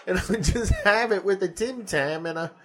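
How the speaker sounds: Aussie bloke defending his bacon; mock Australian accent. Imitating Tea Party Aussie about bacon, Australian accent. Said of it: "Did I do a good Australian accent first?".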